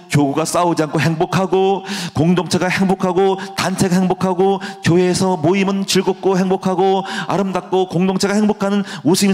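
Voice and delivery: pray, rising in passion; closing prayer that builds to fervor; fervent prayer. Acted.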